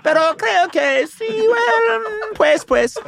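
Spanish. Retold or imitated. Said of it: "I say".

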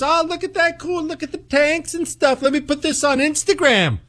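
high, airy mock tourist gushing; spy mocked as a carefree tourist, high and breezy; mock clueless tourist. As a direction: play a dopey sightseer snapping photos. Mocking a naive spy tourist.

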